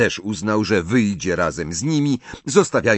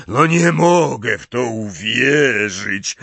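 a lightly voiced, slow, louder giant in disbelief; hoarse Hagrid; 3 semitones higher, 4 dB louder, slower than usual. A narrator's reading. Hagrid staring at Ron in the hospital bed, slow disbelief. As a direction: let the disbelief sink in, slow. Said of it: "powiedział ochrypłym głosem Hagrid".

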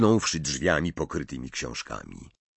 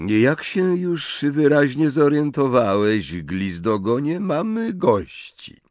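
drawling; 8 semitones higher, 5 dB louder than usual. Acted. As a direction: drag each syllable out with scorn. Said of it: "przeciągając sylaby".

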